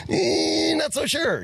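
very high, sliding catchphrase demo; weird upper register. Shown as a demonstration. Co-host showing how the other show talks, weird upper register. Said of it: "say it in a weird upper registered voice".

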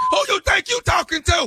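mock angry shout. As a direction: bark it back like a tough inmate. Prank inmate escalates the fight.

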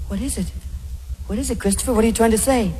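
put-on coaxing baby. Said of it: "There you are going".

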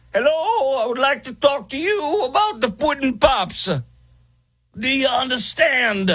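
very high, put-on Bill Cosby voice; a bad Bill Cosby impression. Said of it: "This is my horrible impersonation".

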